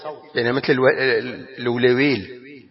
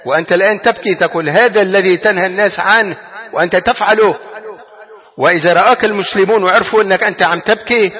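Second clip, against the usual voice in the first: pressing objection; 7 semitones higher, 5 dB louder than usual. Retold or imitated. Voices Ibn Awf's pressing objection.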